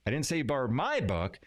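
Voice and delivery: "my" punched above the other words; stress on MY. Shown as a demonstration. Teacher showing how emphasis changes meaning, stress on "my". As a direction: hit the word "my". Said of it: "putting emphasis on different words".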